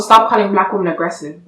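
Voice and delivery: ordinary talk, nothing marked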